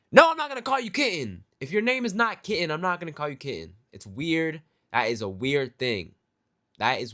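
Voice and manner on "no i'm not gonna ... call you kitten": much higher, louder mock outrage; indignant refusal. Server acting out refusing the customer, outraged. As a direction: refuse shrilly and fast.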